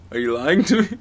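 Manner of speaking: normal talking voice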